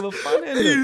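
half-laughed, baffled inner voice; laughing thought. Retold or imitated. Recalling his thought in the church, laughing and baffled. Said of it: "Jag tänker".

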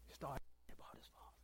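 hushed whisper; barely breathed whisper. Real, not acted. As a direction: drop to a whisper, tenderly. Hushed moment in the story.

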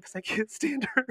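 laughs through the confession; laughing. Real, not acted. Laughing while confessing spite to her friend. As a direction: cackle while admitting spite.

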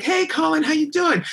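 high-pitched, chirpy mock hello; friendly greeting. Bass player greeting the passing singer, imitated high and cheery. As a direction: imitate an over-friendly nice guy.

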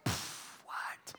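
breathy puzzled whisper; baffled whisper. Pretend. Listener confused by one fruit, whispering. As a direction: scoff quietly, lost. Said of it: "You're like".

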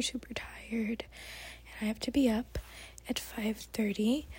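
soft unvoiced whisper throughout. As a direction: whisper so no one wakes up. Whispered night vlog.

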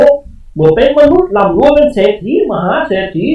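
high, loud grumbling ghost lord; scoffing spirit. A narrator's reading. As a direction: sneer it off like a cranky spirit. (เจ้าพ่อค้อนแล้วบ่นเสียงดัง)

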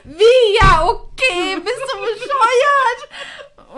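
loud, high, slow, laughing shout of disbelief; laughing mock fury at a friend; incredulous outburst. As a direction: yell it in amused outrage. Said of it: "Und sie so".

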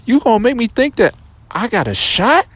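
hurt indignation. Retold or imitated. Confronting the girl who misled him, high and hurt. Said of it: "I'm like".

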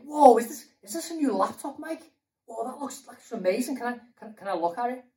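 high-pitched, excited awe; Ed amazed. Acted. Pastor friend spots speaker's brand new laptop, amazed and excited. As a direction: gush like an impressed friend. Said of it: "he's like".

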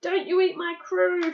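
high, loud defiant shout. A narrator's reading. Captain defending his crew from Santa, shouting. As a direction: shout it up to a giant. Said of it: "shouted the captain".

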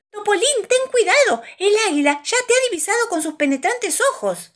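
high, loud alarmed warning; urgent warning. Acted. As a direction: cry out a warning, pitch held high. Aviso de peligro del águila. (aviso alarmado, agudo y fuerte)

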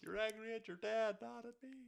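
high-pitched quoted line; lightly voiced movie quote. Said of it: "The Big Daddy quote".

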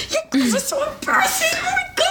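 very high-pitched mock scolding; hammed-up high exasperation with laughter; mortified mom. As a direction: squeal it like an embarrassed mother. Imitating mortified mom scolding her daughters.

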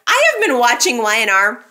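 normal talking voice

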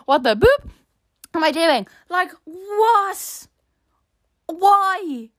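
high, exasperated self-questioning; mock panic. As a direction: wail it in comic mortification. Retold mortified inner panic, censored redo.

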